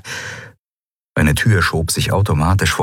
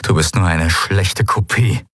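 usual speaking voice

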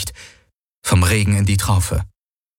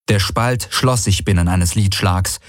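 ordinary delivery, nothing marked

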